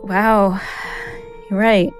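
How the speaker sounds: usual speaking voice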